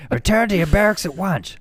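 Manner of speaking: barking a military order; high, stiff drill officer; stern officer voice. Pretend. Mock military order after joke.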